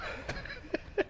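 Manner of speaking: high giggle. Real, not acted. Laughing at the scream effect.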